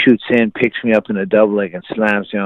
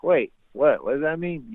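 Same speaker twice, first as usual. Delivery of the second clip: higher, trailing puzzled question; baffled; 6 semitones higher, 5 dB quieter than usual. Retold or imitated. New wrestler puzzled by coach's order, baffled. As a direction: re-enact being lost at practice. Confused novice reacting to coach.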